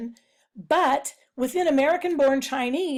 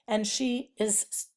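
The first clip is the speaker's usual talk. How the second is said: usual speaking voice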